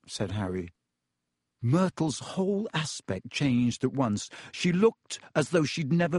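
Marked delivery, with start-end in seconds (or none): none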